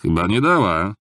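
mild heckling call; jeering shout. A narrator's reading. Newcomer heckles the drunkard from the side, mildly shouted jeer. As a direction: throw it out as a tavern heckle. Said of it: "krzyknął ze strony któryś z nowo przybyłych".

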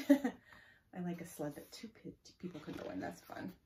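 usual speaking voice

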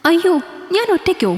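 high, anxious cry of alarm; alarmed worried question. A narrator's reading. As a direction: voice a frightened woman. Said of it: "അവൾ ആശങ്കയോടെ ചോദിച്ചു".

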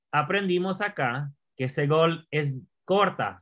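ordinary talk, nothing marked

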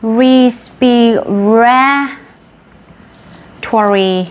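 Respiratory stretched out extremely slowly; very slow teaching model of Respiratory, long held "ra"; syllable by syllable. Shown as a demonstration. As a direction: draw out each syllable of Respiratory, pausing before "tory". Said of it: "bạn nhớ là cái từ này nhá".

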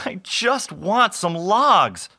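slow, high, plaintive sigh; drawn-out, mildly whiny plea. A narrator's reading. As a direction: sigh it out helplessly. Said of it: "sighed Bob".

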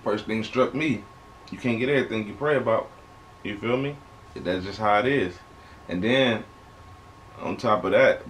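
usual speaking voice

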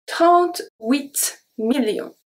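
Trente-huit millions spoken slowly, nasal en; slow pronunciation demo. Shown as a demonstration. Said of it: "E N make the sound en".

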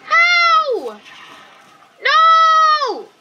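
high, drawn-out yells; real gamer outburst; exasperated shouting. Real, not acted. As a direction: protest each word in disbelief. Frustrated outburst after dying in game.

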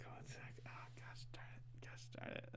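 hushed whisper under the breath. Real, not acted. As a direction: whisper it so no one outside hears. Something alarming happening outside.

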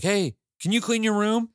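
put-on high-pitched call-out to the kids; yell upstairs. Dad calling kids to clean up, imitated yell. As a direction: imitate a parent barking a chore upstairs. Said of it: "I'll yell upstairs like".

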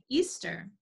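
plain reading voice

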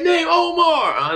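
high, slow, stretched-out call. Retold or imitated. Friends giving his name to the host, called out. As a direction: holler it out for a friend, imitated.

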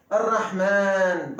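Errahman, the first a stretched long; drawn-out Arabic a. Shown as a demonstration. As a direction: draw out the a of Errahman slowly. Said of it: "Burada uzatma var".

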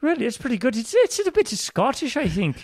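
very high-pitched put-on British Isles accent; Irish-English accent. Guest judging host's accent, in a high Irish-English voice. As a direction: keep the lilting accent way up high.

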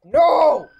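loud, high startled yell; startled shout. Real, not acted. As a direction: cry out in sudden fright. Sudden scare shout.

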